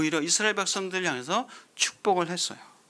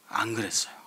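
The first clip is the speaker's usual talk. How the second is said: softer, whispered answer; 8 semitones lower, 5 dB quieter than usual. Acted. The preacher answering his own question in a whisper. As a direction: drop to a hush for the answer.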